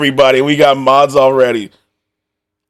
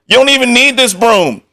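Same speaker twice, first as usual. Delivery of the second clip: usual speaking voice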